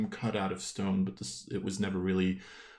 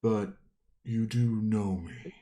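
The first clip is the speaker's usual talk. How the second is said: deep rumbling voice. Acted. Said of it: "you hear a deep rumbling voice".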